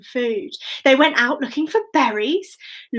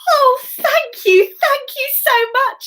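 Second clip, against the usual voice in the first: put-on grateful girl; 12 semitones higher, 3 dB louder, slower than usual.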